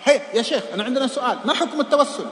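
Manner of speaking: loud, urgent calls of يا شيخ; shouting young men. Retold or imitated. Young men shouting at the sheikh in the mosque, loud and demanding. Youths press the sheikh after lecture.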